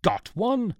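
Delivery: high, loud outraged exclamation; thundering. A narrator's reading. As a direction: explode in disbelief. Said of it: "thundered Newton".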